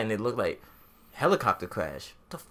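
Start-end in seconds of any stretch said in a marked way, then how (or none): 2.3-2.4 very high, rising "fuck? really?"; squeaky, incredulous reaction; stunned disbelief. Retold or imitated. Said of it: "reacting to helicopter crash news".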